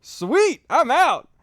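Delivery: hammed-up relief at walking free; mock delight. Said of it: "And I was like".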